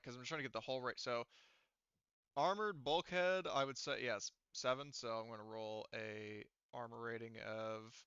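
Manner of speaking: ordinary talk, nothing marked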